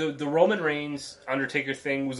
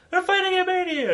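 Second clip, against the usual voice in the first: mock announcement. Mocking the promo line.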